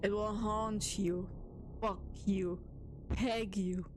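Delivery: slow, hushed horror-story voice; mock spooky narration. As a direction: lure the chat in like a campfire tale. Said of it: "now we're gonna go serious mode".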